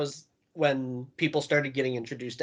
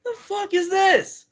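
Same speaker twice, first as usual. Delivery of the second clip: put-on drunk Joey Janela. Storyteller mimics drunk Janela mocking his scarf, high and outraged. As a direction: ham up a drunk wrestler flailing at a scarf. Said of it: "He's like".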